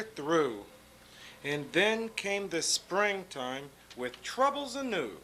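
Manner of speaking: put-on lively read-aloud to children; storybook reading. A narrator's reading. Grown-up reading a picture book to kids, lively storytelling.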